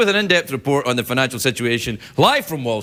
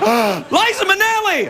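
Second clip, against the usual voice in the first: mock delighted. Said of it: "And he's like".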